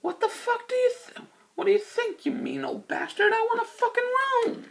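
a squeaky, exaggerated parody of an irritated hotel guest; George Clooney imitation. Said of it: "George Clooney's like".

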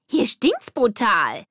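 slow, high, loud disgust; really mean the stink. Acted. Denise disgusted by the stinking ruins.

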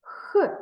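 «Х» breathed out alone as a voiceless sound; isolated sound. Shown as a demonstration. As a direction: give just the sound «Х», breathed out without voice. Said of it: "який перший звук?". (окремий глухий звук «Х»)

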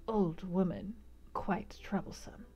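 soft, low servant's aside. A narrator's reading. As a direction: murmur it so the room can't hear. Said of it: "said something to him in a low voice".

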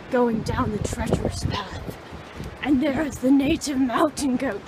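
loud, grand documentary host; mock tour guide. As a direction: announce the trek like an explorer.

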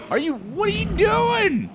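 what are you doing, squeaked; exasperated. Real, not acted. Streamer pleading with baby raptor Fred, high and incredulous.

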